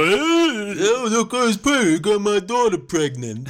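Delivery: high, loud, slow hammed-up dad; overdone panicking father of a pregnant daughter; mock Stallone outrage. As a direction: wail it like a shocked father. Mocking Stallone's reaction.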